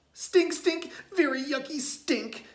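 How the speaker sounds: high, loud disgusted yell. A narrator's reading. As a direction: shout out the stink. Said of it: "yelled Tate".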